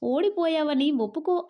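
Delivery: plain reading voice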